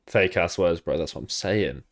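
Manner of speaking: ordinary talk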